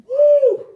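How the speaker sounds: a sudden high, very loud whoop. Real, not acted. Streamer whooping at chat over the ring girl, loud whoop. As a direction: let out a big hype yell.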